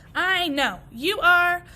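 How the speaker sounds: loud, eager start of an idea; eager blurt. Acted. Walt answering the grasses, loud and eager. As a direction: burst out with a thought.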